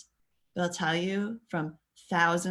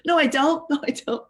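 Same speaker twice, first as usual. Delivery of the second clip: mock denial; 9 semitones higher, 6 dB louder than usual. Kid denying, acted.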